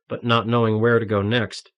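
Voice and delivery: ordinary delivery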